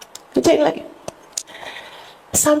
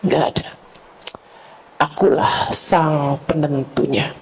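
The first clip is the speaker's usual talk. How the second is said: voice of God; 8 semitones lower, 4 dB quieter than usual. Pretend. Preacher voicing God telling the sinner who owns him, low and solemn. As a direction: speak as the Lord, grave and calm.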